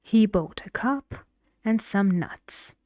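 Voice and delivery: "cup" rising, "nuts" falling to a close; list intonation. Shown as a demonstration. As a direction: lift on "cup", then drop firmly on "nuts". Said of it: "Cup, going up, your voice goes up, and nuts, nuts, going down".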